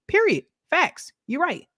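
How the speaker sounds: high, emphatic sassy "Period"; sassy agreement. Real, not acted. Streamer agreeing with a viewer's comment, sassy. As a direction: snap it back with sass.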